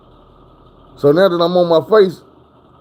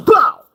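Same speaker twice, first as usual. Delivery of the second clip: very high, loud shouted bow; crash impact noise; 12 semitones higher, 6 dB louder than usual. Retold or imitated. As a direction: blast out the hit like a collision. Truck smacking trees in crash.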